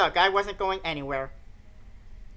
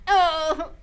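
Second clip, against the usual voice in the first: usual speaking voice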